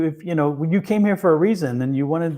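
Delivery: ordinary talk, nothing marked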